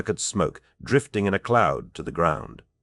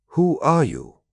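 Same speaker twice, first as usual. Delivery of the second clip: much higher, insistent demand; raised, pointed challenge; insistent repeated question; 10 semitones higher, slower than usual. A narrator's reading. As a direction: press for an answer, pitch leaping up. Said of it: "Hawkmoon asked again".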